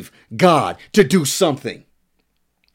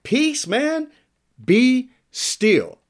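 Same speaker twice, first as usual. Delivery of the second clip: high, loosely acted command; paraphrased rebuke, pitched up; casual rebuke; 8 semitones higher, much slower than usual. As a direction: call it out high to calm someone down. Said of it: "he rebuked the wind".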